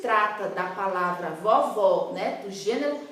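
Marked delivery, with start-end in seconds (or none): 1.3-2.1 VOVÓ low, with open "aw" vowels. Shown as a demonstration. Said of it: "porque o som é aberto".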